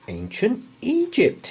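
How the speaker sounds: high, breathy gasp of wonder. Acted. Boy lands in a dark tomb, gasping in wonder. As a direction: gasp it in awe. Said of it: "he gasped".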